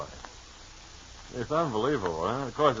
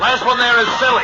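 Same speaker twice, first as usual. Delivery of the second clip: high, loud playground taunt; childish dare; 12 semitones higher, 8 dB louder than usual. Acted. Host playing a fleeing man, loud childish taunt. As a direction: sing out the dare like a kid.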